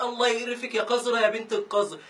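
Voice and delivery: normal talking voice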